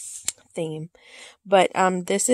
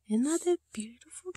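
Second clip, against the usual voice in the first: whispered coo; 6 semitones higher than usual. Real, not acted. Admiring the kitty cat.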